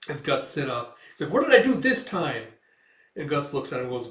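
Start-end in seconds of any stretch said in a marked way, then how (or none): 1.3-2.4 loud, high-pitched alarmed question; acted yelp of innocence; startled protest. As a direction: protest as if caught out.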